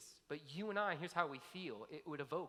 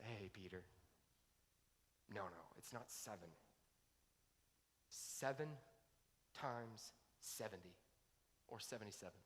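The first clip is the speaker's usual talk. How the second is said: gentle correction; 6 semitones lower, 11 dB quieter than usual. Retold or imitated. Jesus gently corrects Peter.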